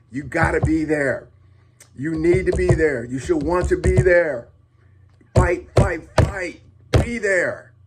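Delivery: loud, high, urgent appeal; passionate plea. Real, not acted. Dad vlogger pleading loudly with fathers to show up. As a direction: pound each line home to the dads. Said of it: "Sorry, getting all passionate".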